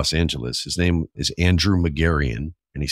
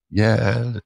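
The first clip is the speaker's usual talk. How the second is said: cheering class; 6 semitones higher than usual. Retold or imitated. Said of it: "It's like".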